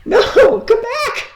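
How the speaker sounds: high pleading laughing call; coaxing a wild bird. Real, not acted.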